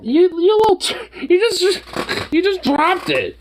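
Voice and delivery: high, drawn-out teasing pet voice. Real, not acted. Teasing the cat.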